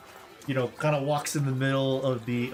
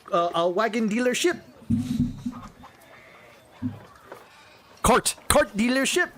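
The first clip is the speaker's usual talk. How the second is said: haughty, high French noble voice, pitched up; snooty French accent; 6 semitones higher than usual. Acted. GM voicing a snobbish French heir in French accent. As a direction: sneer down at the Americans in French accent.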